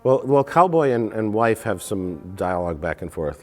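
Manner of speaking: normal talking voice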